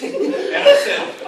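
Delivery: high squeaky giggle through the words; laugh-cracked narration; breaking up laughing. Real, not acted. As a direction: lose it mid-line.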